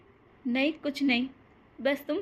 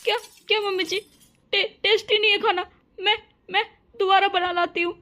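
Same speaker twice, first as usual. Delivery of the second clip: higher, crying and stammering; tearful stammer; 4 semitones higher, 4 dB louder, faster than usual. Acted. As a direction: stammer out an apology through tears. Bride crying over criticized food.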